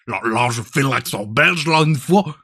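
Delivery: badly faked Belgian French; shaky-skilled Belgian accent. Shown as a demonstration. Streamer retrying after admitting weak Belgian, mock Belgian accent. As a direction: have another go at a Belgian accent. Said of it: "je sais pas bien le faire, le belge".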